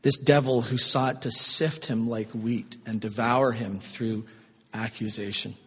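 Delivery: ordinary delivery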